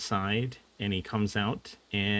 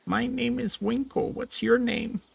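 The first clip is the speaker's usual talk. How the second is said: high, gentle little voice; high little creature voice; tiny creature voice; 12 semitones higher than usual. Acted. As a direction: chirp it like a foot-tall friend. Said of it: "He says".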